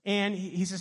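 ordinary delivery

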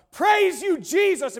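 high, loud cry of praise; shouting praise. Retold or imitated. Pastor shouting in a public washroom, joyful yell. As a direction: throw your arms up and shout it. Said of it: "he'll start shouting out loud".

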